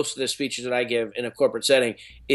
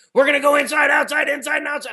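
high-pitched shouted rallying cry, far above usual pitch; imitating own scream; 12 semitones higher, 3 dB louder, faster than usual. Speaker jolting a bank crowd with the Rudy speech, shouted. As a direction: re-enact screaming a pep speech into a microphone. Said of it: "I screamed into the microphone".

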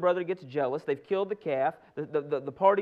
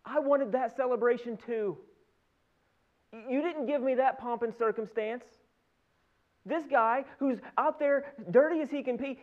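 higher-pitched acted grievance of a jealous brother; indignant complaint; 6 semitones higher than usual. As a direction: complain like the overlooked older son. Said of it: "what is his whole complaint".

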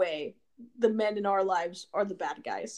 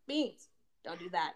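soft mild scolding; 4 semitones lower, 10 dB quieter than usual. Retold or imitated. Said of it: "And Jose's like".

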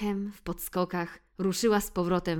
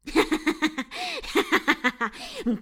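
high loud snickering cat laughter; choking cat laugh; 11 semitones higher, 5 dB louder than usual. Acted. Phil chokes with laughter at the mouse, high snicker. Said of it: "prawie dławiąc się ze śmiechu".

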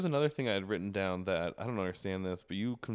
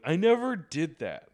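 indignant denial; 7 semitones higher, 6 dB louder, slower than usual. Retold or imitated. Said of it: "she's like".

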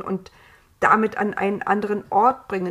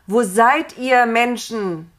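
loud, higher-pitched searching call; 3 semitones higher, 7 dB louder, slower than usual. A narrator's reading. God searching for the humans, calling out. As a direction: call across the garden, voice raised. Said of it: "rief Gott noch einmal".

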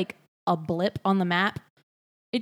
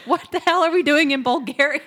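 high, loud baffled cry; bewildered shout, pitched up; loud confused outburst; 6 semitones higher, 6 dB louder than usual. Pretend. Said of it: "You were like".

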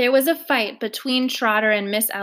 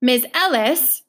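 louder, cutting across her; breaking in loudly; 3 semitones higher, 4 dB louder, slower than usual. Acted. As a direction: interrupt to take over. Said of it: "Trotter broke in loudly".

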